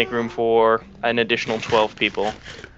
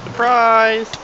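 high, bright party shout; spring the surprise on them; excited; 8 semitones higher than usual. Acted.

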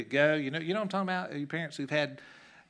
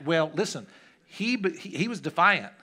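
normal talking voice

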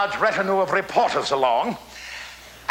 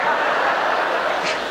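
breathy chuckle. Real, not acted. Chuckling at his own joke.

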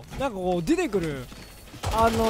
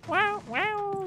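strange voice; 10 semitones higher, 5 dB quieter than usual. Retold or imitated. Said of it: "そんな奇妙な声出さないでくれよ".

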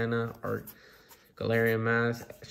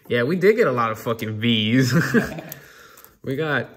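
laughing; 10 dB louder than usual. Real, not acted. Repeats point laughing.

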